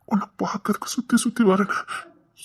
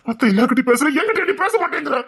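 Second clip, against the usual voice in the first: shouting; 5 semitones higher, 5 dB louder than usual. Acted. Man shouts complaint.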